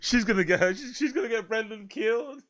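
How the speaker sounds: talking through real laughter; cracking up mid-sentence; laughing. Real, not acted. Laughing at the clip.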